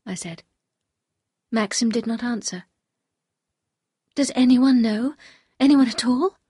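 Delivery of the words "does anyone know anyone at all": higher, louder anxious questions; urgent questioning. Acted. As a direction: press him for an answer, voice raised. Wife anxiously questions husband.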